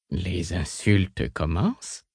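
flat, slow and quiet; unanimated. A narrator's reading. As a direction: say it coldly, without any life. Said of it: "fit-il sans s'animer".

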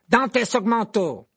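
loud, high, drawn-out incantation; shouted spell. A narrator's reading. Malfoy attacks Harry, shouting a spell. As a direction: cast it with a slow, full shout. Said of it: "s'écria Malfoy".

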